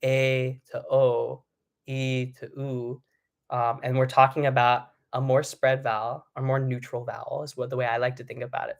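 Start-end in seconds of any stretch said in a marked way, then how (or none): 0.0-3.0 slow, A to O then E to U; bright to dark vowels. Shown as a demonstration. Coach demonstrating bright-to-dark vowel slides, slowly. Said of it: "practice going from a bright to a darker vowel".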